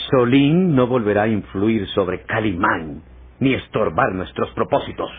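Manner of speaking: normal talking voice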